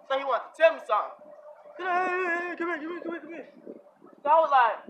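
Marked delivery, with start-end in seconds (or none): 1.8-3.5 high-pitched, rapid mock beckoning that fades softer; homeless man calling out. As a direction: imitate a stranger pestering from afar, voice pitched up. Homeless man calling him over.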